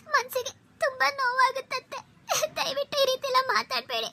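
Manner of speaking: high, soft, sobbing plea to her mother-in-law; crying, pleading daughter-in-law; tearful plea. Acted. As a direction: beg through tears, voice high and soft. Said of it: "ಇಂಥ ಮೊಸಳೆ ಕಣ್ಣೀರಿಗೆಲ್ಲ".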